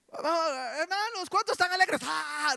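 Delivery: high, hammed-up cheerleader call; mock overexcited worship leader. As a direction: parody the hyped-up leader. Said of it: "no grite así". (líder de alabanza exagerado, agudo y animado)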